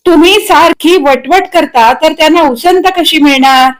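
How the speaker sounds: high, nagging character voice; wifely chiding. A narrator's reading. Wife chiding her chattering husband, high and nagging. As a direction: chide him for hogging the talk.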